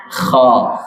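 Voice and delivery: Kha said wide open, lips unrounded; open mouth. Shown as a demonstration. Teacher repeating the right sound for mothers, open Kha. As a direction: gape fully on "Kha". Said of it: "buka mulut dengan sempurna".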